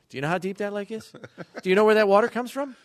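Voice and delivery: high, loud dad lecture voice; lecturing dad. Retold or imitated. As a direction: lecture like a know-it-all father. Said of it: "this is when Dad Brown lecture comes in".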